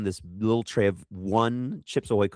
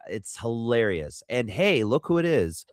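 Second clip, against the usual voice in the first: ordinary talk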